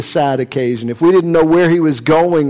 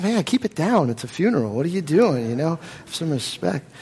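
softer, urgent hushing; hushed rebuke; 8 dB quieter than usual. Acted. Saul hushes Seneca at the funeral, soft and urgent. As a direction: keep it down at a funeral.

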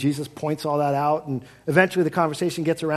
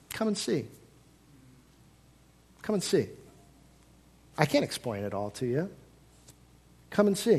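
gentle invitation; 6 dB quieter than usual. Acted. Simple quiet invitation.

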